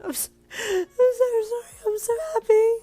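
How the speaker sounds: high, loud sobbing with joy; crying. A narrator's reading. You apologizing to Kuro through happy tears, crying. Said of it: "you cried".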